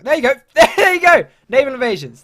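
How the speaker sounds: high, loud burst of vindication; excited shout. Real, not acted. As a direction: shout it out as your prediction comes true. Predicted naval invasion suddenly appears.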